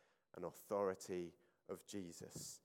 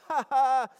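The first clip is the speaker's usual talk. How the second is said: loud, high mock laughter; 18 dB louder than usual. Imitating the mourners laughing at Jesus, loud scornful laugh. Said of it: "a kind of laugh like".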